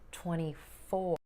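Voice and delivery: twenty-FOUR said slowly with four stressed. Shown as a demonstration. Teacher slowly modelling stress on four. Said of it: "put your stress on the second part".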